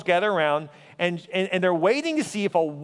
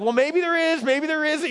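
a missionary's high-pitched, nervous hedge; nervous hedging; 8 semitones higher than usual. Retold or imitated.

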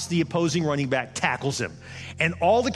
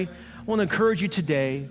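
normal talking voice

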